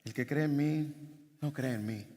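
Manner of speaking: soft, low, hushed mockery of the verse; wrongly hushed and timid reading; mock whispered delivery. Shown as a demonstration. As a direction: say it meekly, almost whispered, as the wrong way. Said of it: "no piense que Jesús está diciendo esto de manera susurrada".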